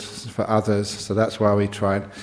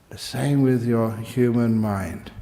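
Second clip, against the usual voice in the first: normal talking voice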